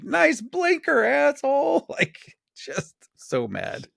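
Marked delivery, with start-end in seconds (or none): 0.0-1.9 high-pitched, drawn-out mock scream; mock road-rage scream. Retelling screaming at drivers from the car, mock rage. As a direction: re-enact yelling at a bad driver, pitched way up. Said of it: "screaming at people like".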